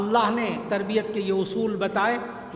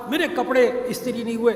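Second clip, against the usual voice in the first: a louder imitated yell from the father; mock yell; 3 semitones higher, 4 dB louder than usual. Father yelling about unironed clothes, mock shout. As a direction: shout the complaint like a demanding husband. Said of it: "یہ باپ چیخ کر بولتا".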